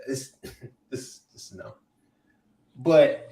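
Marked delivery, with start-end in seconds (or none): 0.1-1.7 a stunned, near-silent "no" with a broken laugh; stunned despair. Retold or imitated. Retelling his reaction to the phone alert, stunned and trailing off. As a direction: let it drain out of you in disbelief. Seeing the coach news on phone.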